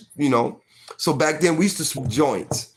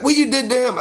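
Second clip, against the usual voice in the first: grandma asking again; 10 semitones higher, 3 dB louder, faster than usual. Retold or imitated. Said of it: "She was like".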